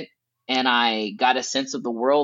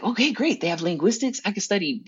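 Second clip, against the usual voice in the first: lightly excited student, higher pitch; nerding out; 5 semitones higher than usual. Retold or imitated. Student discovering linguistics abroad, nerding out. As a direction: geek out over a discovery. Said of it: "nerding out".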